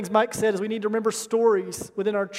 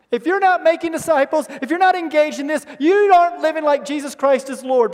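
preaching at full force; 5 semitones higher, 7 dB louder than usual. Acted.

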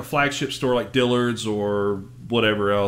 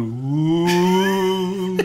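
higher, long mock-ghost wail; spooky ghost "ooh"; 5 semitones higher than usual. SpongeBob and Patrick haunting a kid from the bushes, mock-spooky wail. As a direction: draw out a playful haunting "ooh". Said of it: "come out of the bushes going".

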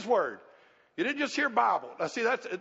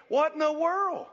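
higher, slow incredulous exclamation; exasperated disbelief; 6 semitones higher, slower than usual. Real, not acted. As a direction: stretch it out in sheer disbelief. Preacher exclaims at listeners' complaints.

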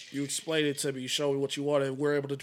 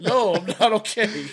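slow, louder, higher mock complaint; mock distraught; 6 semitones higher, 9 dB louder, slower than usual. Answering the boss's apology, mock distraught. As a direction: drag it out, playfully wounded. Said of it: "I said".

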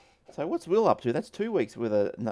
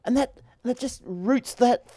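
fed-up rant; 8 semitones higher than usual. Real, not acted. Venting at the computer.

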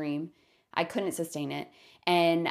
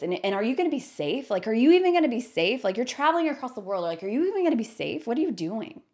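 imitating a fretting friend, higher and fast; alarmed; 5 semitones higher, 3 dB louder, faster than usual. Friend questioning safety of overseas travel, mock-alarmed. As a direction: pile up worried questions urgently.

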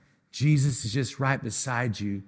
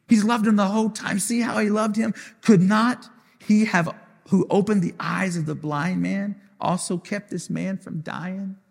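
higher, climbing preacher cry; impassioned preaching; 7 semitones higher than usual. Acted. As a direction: plead it out to the congregation. Preacher voicing the crowd, heightened.

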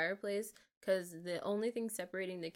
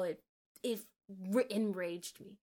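usual speaking voice

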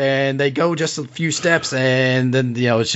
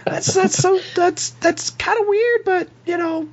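halting falsetto; awkward, squeaky character voice; Clarice, awkwardly flattered. Acted. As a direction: stammer it out very high. Game master plays Clarice, confession received.

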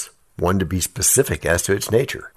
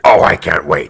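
excited; 3 semitones lower, 8 dB louder than usual. Real, not acted. Eager reply to a coming episode.

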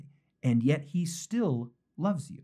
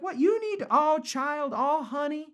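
high, drawn-out Southern belle lilt; half-baked Southern lady, pitched way up; Southern belle accent; 8 semitones higher, slower than usual. Acted. Preacher imagines the author soothing readers, high Southern belle accent. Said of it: "half-baked female Southern accent".

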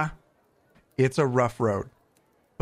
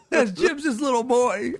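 squealing with delight; 11 semitones higher than usual. Real, not acted. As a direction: overcome with cuteness. Gushing over the cute clip.

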